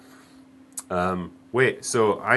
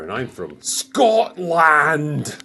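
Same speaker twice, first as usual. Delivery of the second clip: slow, louder, hammed-up Scottish; Scottish accent; 5 semitones higher, 5 dB louder, much slower than usual. Friend imagining a Scottish introduction, hammed-up accent. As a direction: boom it like a proud Scot. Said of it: "it'd be like me saying".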